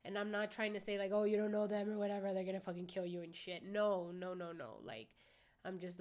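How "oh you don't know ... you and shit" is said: fast, louder mocking voice of a worried friend; mock alarmed warning. Telling viewers what she's not saying, fast mock alarm. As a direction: rattle it off like an overprotective friend.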